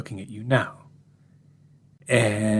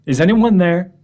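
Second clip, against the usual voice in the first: calling out; 6 semitones higher, 7 dB louder than usual. Retold or imitated. Calls out into empty room.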